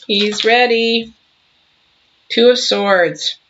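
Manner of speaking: ordinary talk, nothing marked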